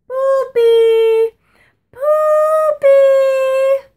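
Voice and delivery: very high, very loud shriek; screaming. Retold or imitated. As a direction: shriek a warning across the lot. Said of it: "she starts screaming".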